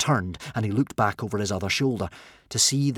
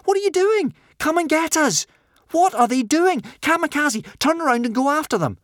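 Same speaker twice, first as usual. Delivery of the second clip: high, loud, desperate calls; shouting; 6 dB louder than usual. A narrator's reading. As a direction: shout after departing boats.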